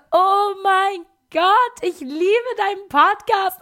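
high, loud, drawn-out fan squeal; hammed-up ecstatic outburst; gushing fan. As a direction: imitate a starstruck fan losing it. Acts fan recognising the podcaster.

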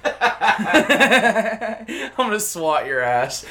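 high, loud threat that breaks into laughter; shrieking with laughter at his own joke; laughing mock threat. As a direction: cackle out a fake threat. Joking threat to British listeners.

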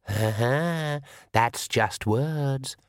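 very slow, sneering dark voice; shadow voice. Acted. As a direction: taunt her slowly from the shadows. Shadow voice mocks Granny.

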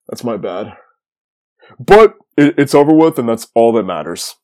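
normal talking voice

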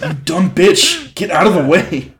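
loud, high, slow furious shout; angry yell. Acted. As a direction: bark at her to move. Acting out Peter holding building.

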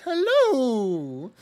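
a high, loud, dopey hello; dumb voice. Retold or imitated. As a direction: say hello like a total dimwit. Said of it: "and he says it really dumb".

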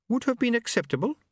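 high, prim and polite; Lady Lejeune voice. A narrator's reading.

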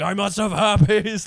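loud, high, drawn-out alarm; mock panic. Retelling his herpes scare to a friend, high mock panic. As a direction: exaggerate a panicked realisation.

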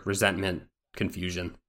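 ordinary talk

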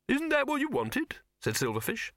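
bustle and dither like an anxious little director; fussy flustered director. Acted. Silverfish instructing Victor and the actress, high-pitched and flustered.

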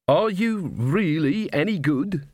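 a drowning man asking for help; dwarf king. A narrator's reading. Desperate dwarf king asks witches.

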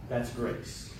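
hushed, measured invitation to prayer; gentle altar call. Acted. Pastor inviting congregation to pray, gentle and hushed. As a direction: ease them softly into prayer, pausing between phrases.

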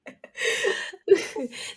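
airy unvoiced laugh; real amused laugh; breathy laugh. Real, not acted. Laughing at own joke.

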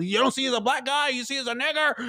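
retold mad rant. Mimicking a comic getting mad at the crowd, angry. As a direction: imitate someone losing it, held back. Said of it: "he's getting mad at the crowd".

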